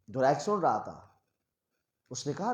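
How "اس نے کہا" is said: ordinary delivery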